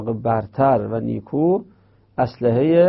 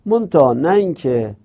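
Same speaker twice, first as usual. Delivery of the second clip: usual speaking voice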